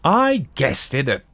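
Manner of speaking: mocking an online commenter's boast; smug commenter. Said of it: "people always say".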